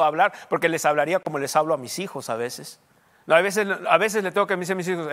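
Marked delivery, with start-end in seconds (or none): none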